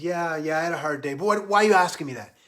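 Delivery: loud, high, quickening retort; combative drunk guy. Retold or imitated. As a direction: snap back loudly, ready to fight. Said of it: "the guy's gonna fight".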